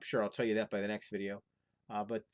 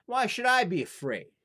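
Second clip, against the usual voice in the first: higher, louder, bold and unbothered; fearless scoff; 10 semitones higher, 8 dB louder than usual. Acted. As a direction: toss it off like nothing scares you.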